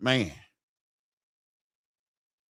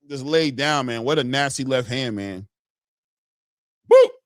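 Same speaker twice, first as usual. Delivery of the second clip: ordinary delivery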